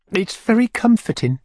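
high falsetto character voice; comforted lady. A narrator's reading. Widow praises the drink to the beadle, high contented voice.

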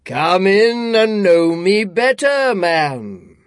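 slow, loud booming giant spirit; echoing ethereal energy being. A narrator's reading. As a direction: boom it out like a jolly giant. Ghost of Christmas Present voiced.

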